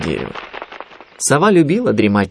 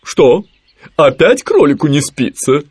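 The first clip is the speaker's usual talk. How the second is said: higher, louder concerned questioning; worried Owl; 6 semitones higher, 5 dB louder, slower than usual. A narrator's reading. Owl worrying about sleepless Rabbit, higher concerned voice. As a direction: ask with a touch of alarm. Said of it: "забеспокоилась сова".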